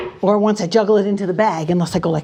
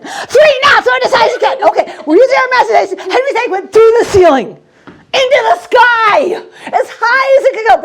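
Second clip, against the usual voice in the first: high, loud, rushing excitement; hammed-up excitement; 12 semitones higher, 7 dB louder than usual. Performer cheering the volunteer's imaginary throw. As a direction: cheer the egg into the sky.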